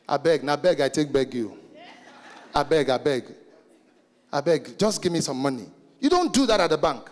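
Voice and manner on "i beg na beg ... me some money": Nigerian pleading. Retold or imitated. Preacher imitating a Nigerian begging the cashier, lower pitch. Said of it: "that's Nigerian for you".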